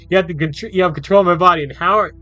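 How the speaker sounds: high, loud frantic questioning; scared and demanding. Acted. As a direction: demand answers in a panic. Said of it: "He sounds even more scared than I feel".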